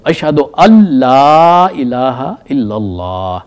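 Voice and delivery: slow recitation, "ان لا" merged into "اَلّا" with a doubled l; recited with silent noon. Shown as a demonstration. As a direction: chant it slowly, the noon swallowed into the l. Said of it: "تو".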